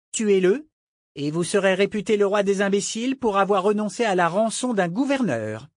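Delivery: high, daring challenge; defiant young woman. Acted. Malta daring the pirate to lose a ransom, scornful. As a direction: throw down the dare in a high voice.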